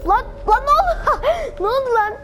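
high, loud surprised laugh; startled laugh. Real, not acted. Falling off the parkour, surprised laughing. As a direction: blurt it out mid-fall, laughing.

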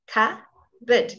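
"Cupboard" broken into two syllables, CUB-bud, no P; silent P. Shown as a demonstration. Teacher shows students there's no P, split syllables. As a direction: split "Cupboard" slowly, leaving out the P. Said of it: "Can you hear? There's no P".

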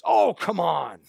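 imitated exasperated brush-off; put-on protest, drawn out; mock brute-force dismissal. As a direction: briefly mimic the dismissive opponent. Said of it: "what I call a brute force tactic".

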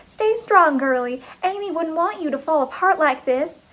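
high, cooing encouragement; sugary, high-pitched consoling; syrupy pep talk. A narrator's reading. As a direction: buck up a crying friend sweetly. Karen's syrupy pep talk to mourner.

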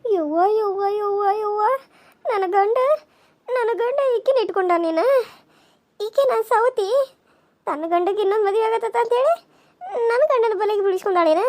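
high-pitched, distressed outcry of a betrayed wife; shocked wailing. Acted. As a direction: wail in dismay at the discovery. Wife overhears husband with rival.

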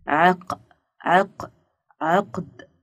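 "عق، عق" broken off on the q, then whole "عقد"; "عقد" with its qaf isolated first; qaf sounded out. Shown as a demonstration. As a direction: build up "عقد" from a repeated "عق". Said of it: "سمعنا صوت حرف القاف".

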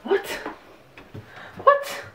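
very high squeal; squeaky excited question. Acted.

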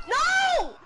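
high loud shout of no; real dismayed shout. Real, not acted. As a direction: yell it in dismay. Dying in game.

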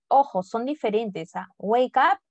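normal talking voice

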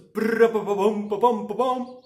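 playful trilled drumroll with pom-poms; suspense-building mouth drums. Pretend. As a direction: imitate a drum fanfare by mouth. Vocal drumroll before the reveal.

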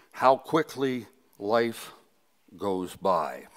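ordinary talk

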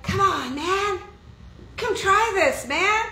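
peer-pressuring friend. Acted. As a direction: coax them like a pushy buddy. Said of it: "your friends are like".

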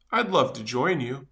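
ordinary delivery, nothing marked

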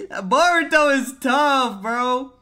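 very loud, high, drawn-out hype; stretched-out fan yell; hyped exclamation. Real, not acted. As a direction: shout it slowly in admiration. Excited reaction to the fight.

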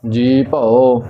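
ਜੀ ਭਉ as two separate words, jee pao; long ਜੀ, then a detached ਭਉ; split pronunciation. Shown as a demonstration. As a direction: break the word into ਜੀ and ਭਉ. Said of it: "ਇਸ ਪ੍ਰਕਾਰ ਇਸ ਦਾ ਉਚਾਰਨ ਨਹੀਂ ਕਰਨਾ".

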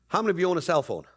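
ordinary talk, nothing marked

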